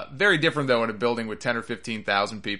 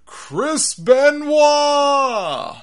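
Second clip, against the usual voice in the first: loud, high holler with the last syllable stretched long; heckler's shout; 11 dB louder, much slower than usual. Retold or imitated. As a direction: imitate a fan yelling out, very high and loud. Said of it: "one guy who just shouts out".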